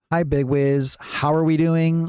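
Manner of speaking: high, drawn-out sing-song greeting; imitated chatter greeting. Streamer quoting a chatter's greeting back, imitated high and slow. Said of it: "Whenever you say, for example".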